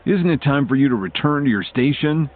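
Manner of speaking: mildly stern dismissal; said with a glare. A narrator's reading. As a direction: send an underling off with restrained annoyance. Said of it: "Drake said with a glare".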